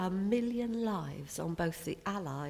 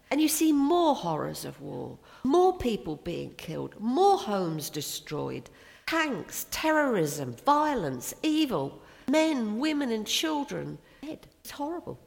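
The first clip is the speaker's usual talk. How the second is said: louder, more forceful with each word; rising preaching; 4 semitones higher, 6 dB louder than usual. Acted. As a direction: hammer home each horror of war. Preacher lists horrors of war.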